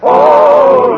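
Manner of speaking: usual speaking voice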